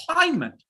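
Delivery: "Climate" said high, punched on CLI, falling after; stress on first syllable. Shown as a demonstration. Teacher leads students repeating "Climate", stress on CLI. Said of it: "Say it, everyone".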